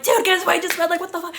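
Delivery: high-pitched, amazed friend-to-friend blurt; excited retelling. Retold or imitated. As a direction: act out breaking big news, pitched high. Acting out texting friend excitedly.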